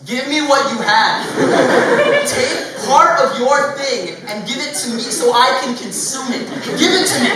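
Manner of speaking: high, loud, slowed greedy begging; hammed-up grabby plea for your food; loud, slow mock demand to hand it over. What food compliments really mean.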